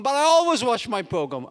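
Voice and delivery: lightly acted indignant boy; protesting child. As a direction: protest like a thwarted kid. Boy protests losing his program.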